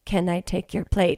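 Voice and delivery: soft and eerie, lightly voiced; creepy waiter voice.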